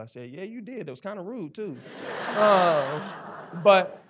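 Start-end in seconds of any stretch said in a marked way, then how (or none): 0.3-1.8 higher, softer, teasing the Lord; a cheeky little jab, said lightly; higher and softer playful reply, half joking. Retold or imitated. Teasing reply to God, retold. 1.8-2.3 short soft unvoiced chuckle; a quick airless laugh at his own joke; quiet chuckle under the breath; soft breathy laugh. Real, not acted. 2.4-4.0 louder, higher, breaking into a laugh; a laugh spilling into the word. Real, not acted. Laughing after his joke.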